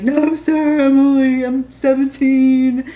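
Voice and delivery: high, loud hammed-up wail; mock sobbing. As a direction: imitate a bawling teen confessing to an officer. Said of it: "I just start crying".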